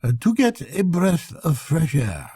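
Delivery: a dainty clerk explaining his stroll; accountant's voice. Acted. Accountant to narrator, lightly voiced prim clerk. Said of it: "he said".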